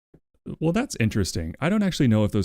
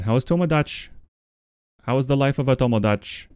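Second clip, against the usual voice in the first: mock Irish accent; 3 semitones higher than usual. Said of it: "Pseudo South African Irish combo".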